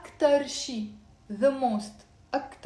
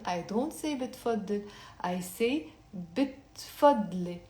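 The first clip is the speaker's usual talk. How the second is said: normal talking voice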